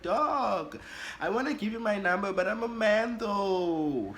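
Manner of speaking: high-pitched pleading protest, stretched at the end; exasperated protest. Acted. Telling the suitor I'm a man, exasperated protest. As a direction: protest up high, drawing out the last words.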